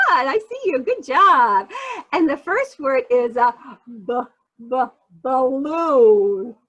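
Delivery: normal talking voice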